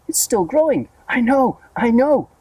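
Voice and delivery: high, loud giddy shout; excited shout. A narrator's reading. Aunt shouts in excitement at the growing peach. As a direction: shout it, beside herself. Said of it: "shouting ... in their excitement".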